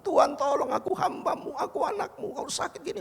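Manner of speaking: preacher retells crying out to God in pain, pitched high. Retold or imitated. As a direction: plead up to heaven in a high voice. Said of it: "saya teriak sama Tuhan".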